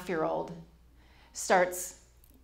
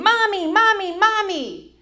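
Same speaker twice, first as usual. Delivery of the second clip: put-on high, loud little-boy call across the pool; toddler yelling mommy; 12 semitones higher, 8 dB louder, slower than usual. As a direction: call out like a small child spotting his mom. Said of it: "he starts yelling".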